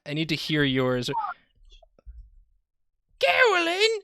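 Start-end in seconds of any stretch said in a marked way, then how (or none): 3.2-4.1 shrill cartoonish call out; high calling character voice. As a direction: call her name in character.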